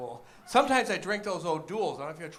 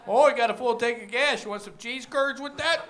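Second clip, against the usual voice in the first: high, loud Wisconsin clerk pushing curds; mock Midwestern shop clerk; lightly voiced Wisconsin clerk; 5 semitones higher, 7 dB louder than usual.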